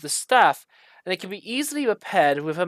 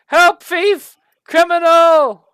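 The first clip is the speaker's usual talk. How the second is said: alarm cries; 12 semitones higher, 9 dB louder, slower than usual. Acted. Staff alarm keeps crying out.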